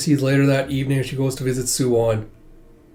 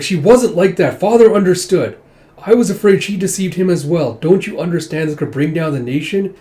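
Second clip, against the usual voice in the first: higher, louder protest; heated retort; 5 semitones higher, 6 dB louder than usual. Retold or imitated. Son protesting to his mother, heated and raised. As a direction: push back hard at her. Brother argues back heatedly.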